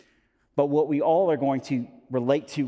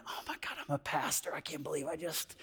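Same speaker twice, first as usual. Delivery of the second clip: 1 mortified whisper; 13 dB quieter than usual. Retold or imitated. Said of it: "And I'm like".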